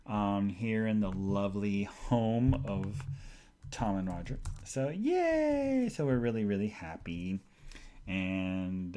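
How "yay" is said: high, drawn-out little cheer; cheery yay. Real, not acted. Celebrating getting the house organized, high drawn-out cheer. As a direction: let the cheer rise and hang.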